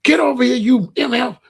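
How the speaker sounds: high, loud scolding yell at her son; mother yelling at her son; angry yell. Retold or imitated. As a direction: bark at the kid like an angry mother. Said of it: "She said, she like".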